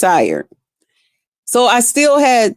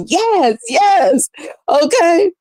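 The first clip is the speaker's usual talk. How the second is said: gleeful cheering; 8 semitones higher, much slower than usual. Retold or imitated. Retelling her gleeful cheering.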